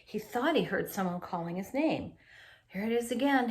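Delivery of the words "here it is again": ordinary delivery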